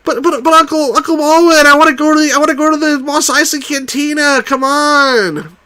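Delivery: high pleading whine to his uncle; whiny kid Luke. Retold or imitated. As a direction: beg Uncle Owen like a whiny child. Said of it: "a sort of a whiny kid".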